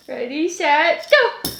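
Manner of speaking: slow "Ready, set" stretched out, then "go" jumps up high; drawn-out countdown. Real, not acted. Mom counting down a ball game for her toddler, slow and playful.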